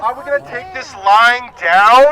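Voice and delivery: loud rallying call to the crowd; rabble rouser. Real, not acted. Rally speaker riling up crowd.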